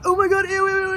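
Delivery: high-pitched squeal of disgust; high, squealed mock yell. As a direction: imitate friends yelling in grossed-out panic. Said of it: "they're, like, yelling".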